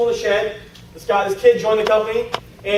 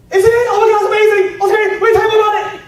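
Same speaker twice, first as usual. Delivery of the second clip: high loud over-the-top gushing; overexcited gush; 10 semitones higher, 4 dB louder than usual. Retold or imitated. Mocking himself as a new recruit, loud high gushing. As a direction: gush like a thrilled beginner. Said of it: "'Cause you're excited".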